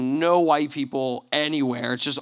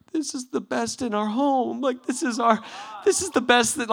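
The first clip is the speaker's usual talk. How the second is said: tearful mom; 8 semitones higher than usual. Retold or imitated. Mom offering her best wine, tearful. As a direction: imitate a mother on the edge of tears. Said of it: "starting to tear up".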